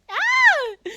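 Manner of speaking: high, loud yelp; frustrated scream. Real, not acted. Streamer after fumbling lyrics, high scream. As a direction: let out a piercing cry.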